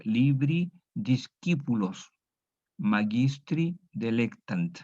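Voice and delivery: Latin read slowly word by word; slow reading aloud. Shown as a demonstration. Teacher reads the Latin sentence slowly to the class. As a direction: spell it out slowly for students. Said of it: "vamos a leer".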